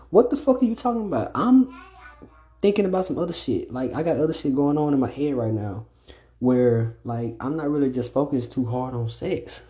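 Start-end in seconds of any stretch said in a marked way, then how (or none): 0.1-3.7 exasperated outburst. Retold or imitated. Exasperated reply to imagined girlfriend.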